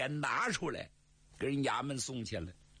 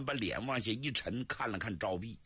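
plain reading voice

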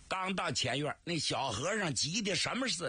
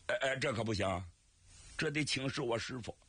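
ordinary delivery

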